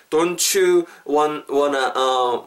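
drawn-out DON'T YOU, stumbling on WANT, WANNA, then a filler; halting, broken delivery. Shown as a demonstration. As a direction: grope through the words piece by piece. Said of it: "막 이렇게 하실 거면". (더듬거리며 끊어서 말하기)

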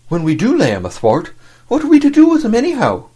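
high, fast, eager question; rising keen and quick; excited. A narrator's reading. Dick's eager bloodthirsty question.

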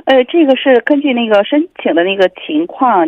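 ordinary talk, nothing marked

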